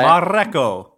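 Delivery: "Mareko" with a long trilled R; over-rolled R. Shown as a demonstration. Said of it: "just one roll though, Craig".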